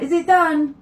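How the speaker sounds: calling out from another room. Retold or imitated. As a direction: shout it through the door, impatient. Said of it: "he calls out".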